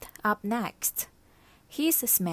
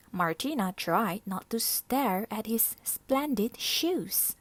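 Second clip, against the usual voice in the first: ordinary delivery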